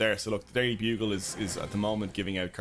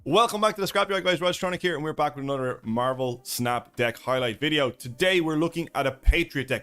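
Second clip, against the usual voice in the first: video intro presenting; 2 semitones higher, 4 dB louder than usual. Real, not acted. Streamer recording the intro for his deck video, presenting. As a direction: present it to camera with punched key words.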